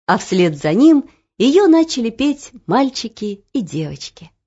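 usual speaking voice

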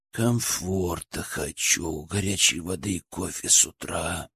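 low, soft, slow grumble; through clenched teeth. A narrator's reading. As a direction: mumble it slowly without unclenching your teeth. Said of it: "не разжимая зубов". (сквозь зубы, тихо и медленно)

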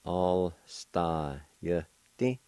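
Alstayeti drawn out very slowly, each syllable stretched; slow syllable demo. Shown as a demonstration. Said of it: "It's pronounced like this".

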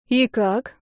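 a touch of wariness in the question; suspicious. A narrator's reading. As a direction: ask with understated suspicion. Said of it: "подозрительно спросила я". (с лёгким подозрением)

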